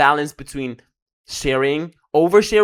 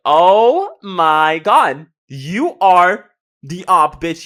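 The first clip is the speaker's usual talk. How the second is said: loud self-scolding; 6 dB louder, much slower than usual. Retold or imitated. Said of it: "and I'm like".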